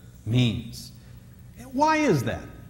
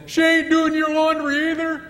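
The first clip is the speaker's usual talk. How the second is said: high, laughing mock of buddies; teasing guys; 13 semitones higher, 4 dB louder than usual. Guys teasing a buddy about laundry, high and laughing. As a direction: rib a friend in a high, laughing voice. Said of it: "they'll all say".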